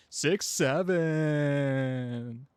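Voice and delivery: flat, drawn-out "Six seven"; deadpan six seven. Shown as a demonstration. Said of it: "just say".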